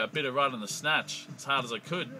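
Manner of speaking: ordinary talk, nothing marked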